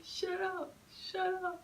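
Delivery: high, strained retold shout; mock yell. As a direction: fling it back like fed-up riders. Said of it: "They were just yelling".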